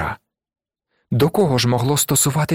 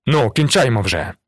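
mildly raised, impatient demand; understated angry exclamation; furious call. A narrator's reading. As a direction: cut the talk short with irritation. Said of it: "гукнув розлючений Жавер".

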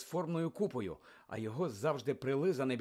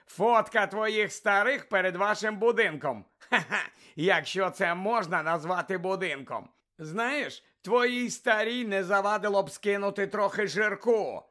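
sneering taunt; 5 semitones higher, 5 dB louder than usual. Acted. Malfoy taunting Ron's family.

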